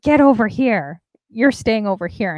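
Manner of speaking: a hammed-up stern summons; bossy demand. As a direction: playfully imitate her calling him back firmly. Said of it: "she'll be like".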